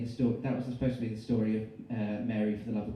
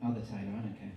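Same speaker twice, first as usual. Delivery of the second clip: plain reading voice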